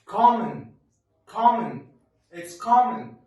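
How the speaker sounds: Common said high and loud with an open ah; correct pronunciation. Shown as a demonstration. Teacher modelling the right way to say common. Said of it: "it would be as what".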